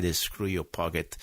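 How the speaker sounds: ordinary talk, nothing marked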